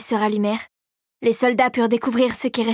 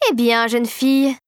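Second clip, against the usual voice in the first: ordinary delivery, nothing marked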